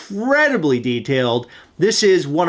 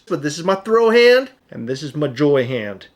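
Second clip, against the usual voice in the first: ordinary delivery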